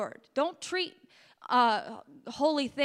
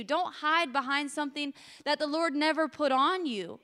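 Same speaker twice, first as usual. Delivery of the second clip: ordinary delivery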